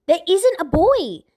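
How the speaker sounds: put-on high, loud girl's snap; girl snapping. A narrator's reading. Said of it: "Aenor snapped".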